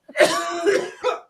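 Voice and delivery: rough choking cough; hacking cough. Real, not acted. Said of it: "a fly flew in my fucking mouth".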